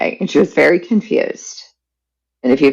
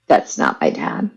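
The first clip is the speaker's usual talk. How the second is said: painful secret spoken in a whisper; 10 semitones lower than usual. Real, not acted. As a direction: barely breathe the words out. Revealing painful family secret.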